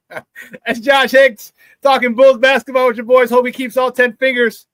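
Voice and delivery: loud, high sign-off through real laughter; laughing. Real, not acted. Host signing off guest before fireworks weekend, laughing. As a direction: announce it while cracking up. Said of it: "I'm laughing, but it's actually not".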